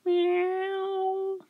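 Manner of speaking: very high, thin meow; imitated high meow; cat's meow. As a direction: mew like a small cat. Cat replies.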